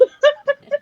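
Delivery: high loud laugh; high burst of laughter. Real, not acted. Laughs at friend's story.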